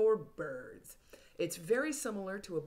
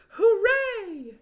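excited cheer; 11 dB louder than usual. Acted. Reading book to kids.

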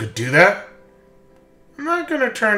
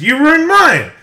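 angry outburst; 12 semitones higher, 4 dB louder, slower than usual. Acted. Acted rival argument.

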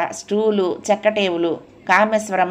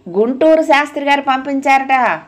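much higher, somewhat louder, carrying each word; raised voice; 7 semitones higher, 4 dB louder than usual. A narrator's reading. Husband repeating news for his wife to hear, raised voice. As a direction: speak up for someone hard of hearing. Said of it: "కొంచెం గట్టిగానే చెప్పాడు".

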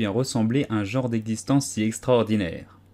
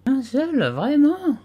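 much higher, offended disbelief; indignant; 3 dB louder, much slower than usual. A narrator's reading. Dormouse indignant at Alice's doubt, high and offended. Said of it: "d'un ton indigné".

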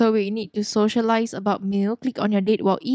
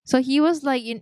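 ordinary talk, nothing marked

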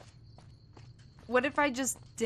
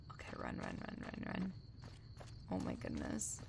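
whispered panic; 14 dB quieter than usual. Real, not acted. Fleeing enemies.

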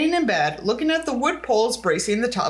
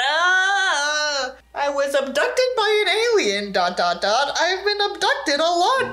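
higher, slow hammed-up spooky voice; mock dramatic confession; 4 semitones higher, slower than usual. Boy blurting his alien story to his papa, hammed up. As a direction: ham it up like a tortured mystic.

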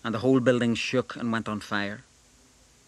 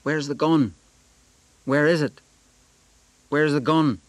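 louder, higher demanding bark; police demand; 5 semitones higher, 6 dB louder than usual. A narrator's reading. Police officer barking at the boy, demanding the gun. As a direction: press him for the gun.

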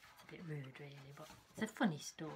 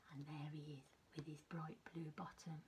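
much softer whispered giggly aside; 3 semitones lower, 12 dB quieter than usual. Real, not acted. Showing the children the monkey's bottom, whispering. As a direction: breathe it out like a secret.

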